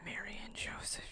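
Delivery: breathless shocked whisper. Real, not acted. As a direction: hush it like a scandal. Whispered exclamation aside.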